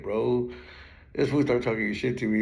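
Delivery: normal talking voice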